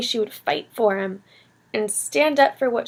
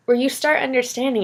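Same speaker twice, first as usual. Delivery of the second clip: usual speaking voice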